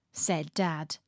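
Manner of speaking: usual speaking voice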